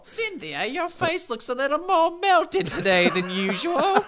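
put-on high, drawn-out old Englishwoman; old English lady. Podcaster mocking a burned woman's face, as an old English lady. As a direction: screech it slowly like a Monty Python lady. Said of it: "old English lady voice".

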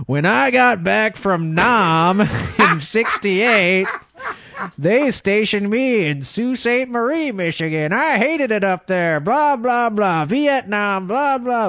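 put-on high, slow old vet rambling on. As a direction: do the bar vet droning about Nam. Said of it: "He said".

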